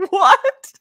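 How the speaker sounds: high, loud laughing disbelief; laughing what. Retold or imitated. Fan laughing at a silly line in the show, loud laughing what. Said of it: "And I was like".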